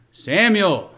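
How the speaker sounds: loud, high call of the name; God calling out. Pretend. Preacher voicing God's call to the sleeping boy, loud and high. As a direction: call across the dark to wake him. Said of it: "How did it sound?".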